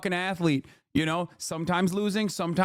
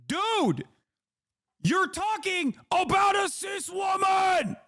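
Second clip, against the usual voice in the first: real exasperated outburst, pitched way up; exasperated shout; 8 semitones higher than usual. Real, not acted. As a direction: yell it in disbelief, voice cracking up high. Exasperated rant at believers.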